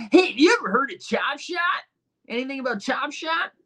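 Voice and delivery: high, bright interviewer voice; mock street interviewer. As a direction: play a pushy street reporter.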